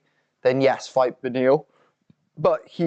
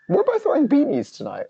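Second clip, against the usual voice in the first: squeaky high voice; 14 semitones higher than usual. Real, not acted. Sudden high squeaky line.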